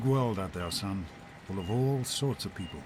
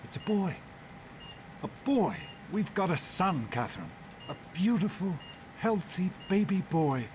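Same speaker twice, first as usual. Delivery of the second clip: overjoyed; 7 semitones higher than usual. Acted. Father overjoyed at birth.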